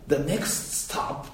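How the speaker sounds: The next stop in smooth, soft, slow announcer English; a soft, unhurried station announcement; fluent announcer English. Retold or imitated. Speaker imitating the subway's fluent English announcement. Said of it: "すっごいなんか流暢な".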